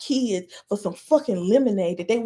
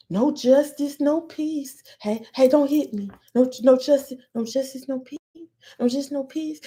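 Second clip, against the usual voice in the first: mock protester; 3 semitones higher than usual. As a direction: imitate a feeble marcher, pitched up and faltering. Mocking peaceful marchers being hit.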